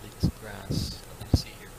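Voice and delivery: hushed sneaky whisper. Pretend. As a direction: whisper it like nobody's watching. Sneaky boar narrated in whisper.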